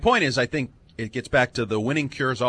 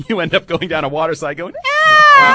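scream; 3 semitones higher, 6 dB louder than usual. Retold or imitated. Water slide scream.

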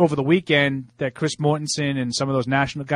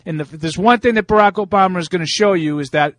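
put-on raised, preachy Boston accent; 4 semitones higher, 4 dB louder than usual. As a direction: lecture the country in a Boston accent. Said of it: "are you affecting an accent there".

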